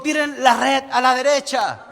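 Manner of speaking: projected retold instruction; retold call from shore. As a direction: call it out mildly across water. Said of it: "Jesús le gritó".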